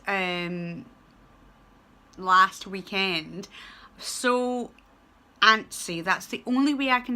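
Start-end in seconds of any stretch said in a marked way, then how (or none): none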